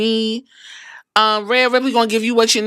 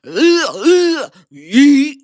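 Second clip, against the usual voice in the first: loud mock grunting then yeet; mock grunts and yeet; 4 dB louder, much slower than usual. Vlogger mimics Jey Uso's ugh ugh yeet, loud. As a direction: grunt it out like a wrestler's entrance. Imitating wrestler's catchphrase.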